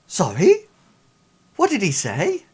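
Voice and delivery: sudden high, loud startled ask; alarmed question. Acted. Worried hostage Sinclair asks the crew what was said, alarmed. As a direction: jump in shocked and high.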